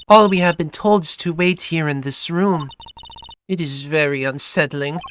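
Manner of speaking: put-on lightly European-accented singer; hint of a European accent; vague European accent. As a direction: speak carefully in a loose European accent. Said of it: "vaguely European accent".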